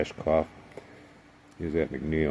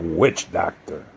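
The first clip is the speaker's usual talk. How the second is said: retold yell; 4 dB louder, slower than usual. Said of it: "and I yelled".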